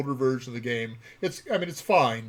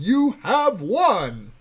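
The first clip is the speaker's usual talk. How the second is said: excited; 6 semitones higher, 5 dB louder, much slower than usual. Real, not acted. Announcing the raffle winner.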